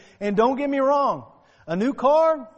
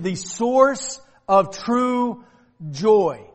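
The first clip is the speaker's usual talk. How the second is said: slowed emphasis; much slower than usual. Acted. Preacher stretching key phrase.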